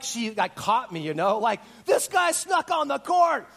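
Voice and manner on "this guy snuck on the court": high, loud, drawn-out alarm call; accusing shout. Retold or imitated. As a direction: shout out that you've caught an intruder. Acts guard catching him out.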